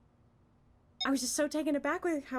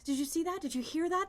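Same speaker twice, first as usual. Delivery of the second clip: usual speaking voice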